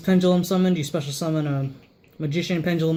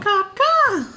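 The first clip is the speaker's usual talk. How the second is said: high squawking bird cry; shrill screeching bird woman; 7 dB louder than usual. Pretend. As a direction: squawk it like a crow. Bird call imitation.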